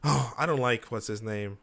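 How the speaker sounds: ordinary delivery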